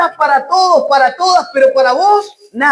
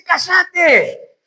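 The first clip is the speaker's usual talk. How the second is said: high, shouted command for silence; crowd's rebuke; 5 semitones higher than usual. Acted. Crowd answering the blind man's cry, scolding. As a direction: raise the pitch and silence him. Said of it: "la gente decía".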